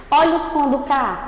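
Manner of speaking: ordinary talk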